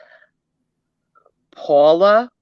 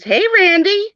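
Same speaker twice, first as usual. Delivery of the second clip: high, loud greeting to a classmate; calling out; 5 dB louder than usual. Acted. Jody hails classmate Randy at school, high loud call. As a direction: call out high and bright across to a friend.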